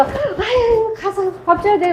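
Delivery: high, half-laughing woken-up sigh; imitating herself woken at night; high-pitched sleepy complaint with a laugh; groggy sigh. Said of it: "막 이러면서".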